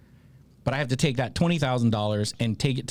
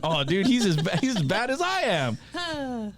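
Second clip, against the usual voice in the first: amused disbelief; 7 semitones higher, slower than usual. Retold or imitated. Amused at the clueless dad.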